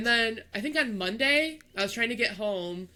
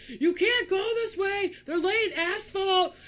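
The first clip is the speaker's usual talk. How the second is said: much higher, slow shouted warning; worker shouting; 11 semitones higher, slower than usual. Retold or imitated. Worker warns the driver through the window, slow shout. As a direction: shout it out like a road worker over the noise. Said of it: "and he goes".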